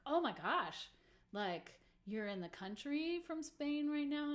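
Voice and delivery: high, impressed reaction; gushing amazement. Retold or imitated. Musician reacts to fan's long trip, high gushing amazement. Acting own amazement at the fan.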